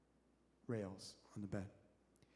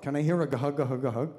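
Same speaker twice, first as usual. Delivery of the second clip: Uncle Howard's gah; 9 dB louder than usual. Shown as a demonstration. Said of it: "everybody in our family imitates Uncle Howard".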